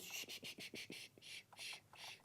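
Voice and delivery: soft unvoiced shh to hush a baby; shushing whisper. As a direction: breathe the shh out like hushing an infant. Mum shushing crying baby.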